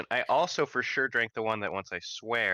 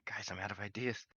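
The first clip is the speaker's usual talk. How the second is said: very soft stage whisper; hushed, conspiratorial admission; whispered aside; 11 dB quieter than usual. Acted. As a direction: whisper it secretly to your teammates. Aside to teammates during bluff.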